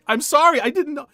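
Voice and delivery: high, loud, startled sorry; panicked apology. Retold or imitated. Young man yelping sorry at the angry girl. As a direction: yelp the apology in alarm.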